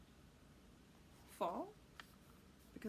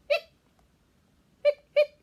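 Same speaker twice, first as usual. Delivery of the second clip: hiccup sounds; 20 dB louder than usual. Acted. Skeleton hiccuping.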